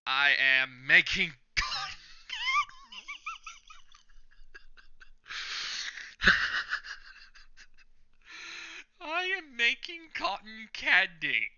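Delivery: loud booming hero line broken by laughter; laughing All Might gag. Retold or imitated. Reader doing All Might's cotton candy gag, cracking up. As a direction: belt it out then dissolve into giggles.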